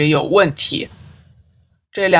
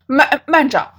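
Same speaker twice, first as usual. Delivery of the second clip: breaks off and restarts, higher; stammered sudden interruption; cut off mid word; 10 semitones higher than usual. Acted. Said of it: "T T打断关正多的话".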